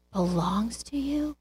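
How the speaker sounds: breathy tender whisper; hushed fatherly whisper. Acted. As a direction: whisper it like a secret gift. Father gently correcting son.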